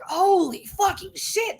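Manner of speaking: high, slow, stunned exclamation; imitated shock. Medical staff hearing about the shot, high imitated shock. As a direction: draw it out high in mock disbelief.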